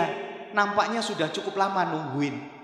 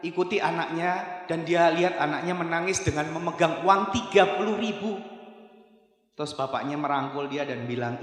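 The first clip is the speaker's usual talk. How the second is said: usual speaking voice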